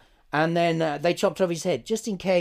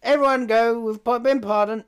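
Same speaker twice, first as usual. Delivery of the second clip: mock announcement; 8 semitones higher, 7 dB louder than usual. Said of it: "and then he goes".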